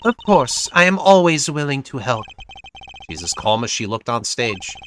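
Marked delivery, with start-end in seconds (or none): 0.0-2.2 put-on lightly European-accented singer; hint of a European accent; vague European accent. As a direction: speak carefully in a loose European accent. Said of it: "vaguely European accent".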